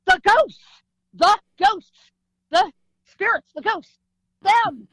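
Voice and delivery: loud, high, in gasped bursts; frantic exclamation. Retold or imitated. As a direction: blurt it out to make dad understand. Winded, alerting dad to ghosts.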